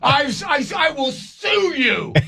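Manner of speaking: angry threat. Retold or imitated. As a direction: shout the threat, outraged. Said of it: "I was so mad at them".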